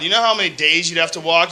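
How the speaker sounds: normal talking voice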